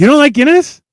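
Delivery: high, loud, rising disbelief; astonished question. Real, not acted. To a co-host who dislikes Guinness, astonished. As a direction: ask it as if truly shocked.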